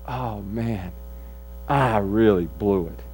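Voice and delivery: low, hushed self-reproach; rueful realization. Pretend. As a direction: sink into quiet, acted regret. Voices listeners' rueful private realization.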